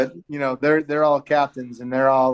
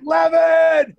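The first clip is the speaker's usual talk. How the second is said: retold yell; 13 semitones higher, 6 dB louder than usual. Friend yells name on arrival.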